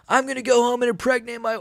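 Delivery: high, raspy Brazilian fighter voice; Moicano voice. Retold or imitated. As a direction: do a Moicano impression. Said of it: "a decent Moicano".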